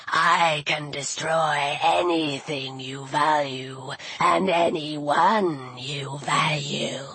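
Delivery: deep, slow menacing serpent; serpent villain. A narrator's reading. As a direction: threaten slowly, each word stretched out low. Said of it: "the serpent spoke through the statue's mouth".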